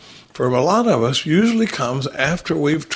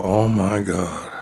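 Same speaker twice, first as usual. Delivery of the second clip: slow stunned gasp; 6 semitones lower, much slower than usual. Retold or imitated. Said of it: "I love to hear him in the fourth column go".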